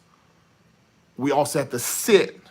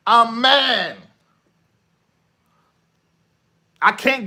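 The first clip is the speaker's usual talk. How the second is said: rising, impassioned rant, ending high and loud; acted angry protester; 5 semitones higher, 3 dB louder than usual. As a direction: pour out hurt and anger, building with each "I'm mad". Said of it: "that's how it comes out".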